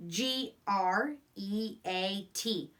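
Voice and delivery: letters spaced slowly and evenly; slow spelling out. Shown as a demonstration. Teacher spelling a sight word aloud slowly.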